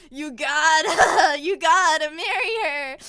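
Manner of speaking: very loud, drawn-out mock bellow; hammed-up fatherly insistence; insistent dad. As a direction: belt out the order, breaking into laughter. Dad insists, repeated louder.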